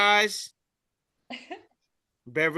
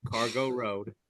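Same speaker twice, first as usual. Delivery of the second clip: deadpan; 6 semitones lower, 5 dB quieter than usual. Retold or imitated. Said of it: "The guy says".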